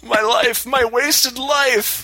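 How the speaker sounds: high, slow hammed-up lament; husband mock laments his wasted life, high and slow. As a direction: wail it like a ruined man.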